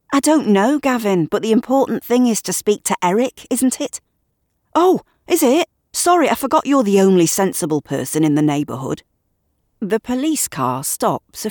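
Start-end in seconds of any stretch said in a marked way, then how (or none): 0.1-4.0 higher and louder, testy; irritated retort. Acted. Shirley snapping back at Gavin in the street, irritated. 4.7-9.0 high mocking "Oh, is it?" then fast; mock her self-importance; sarcastic. Gavin sneering at Shirley's sensible act, sarcastic.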